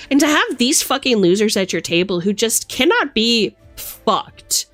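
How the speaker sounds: ordinary talk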